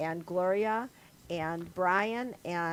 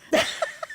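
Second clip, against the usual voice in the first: high giggle. Real, not acted. Laughing at her Play-Doh cake story.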